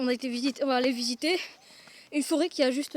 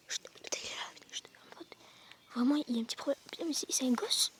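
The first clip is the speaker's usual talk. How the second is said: soft unvoiced whisper, hiding; whispering while hiding; 2 semitones lower, 10 dB quieter than usual. Real, not acted. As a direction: whisper so he can't hear you. (chuchoter en se cachant)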